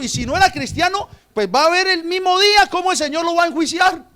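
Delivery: loud, high sermon climax; fiery preaching. Acted. (predicación fervorosa y a todo volumen)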